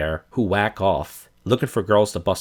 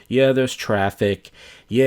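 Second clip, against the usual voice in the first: ordinary talk, nothing marked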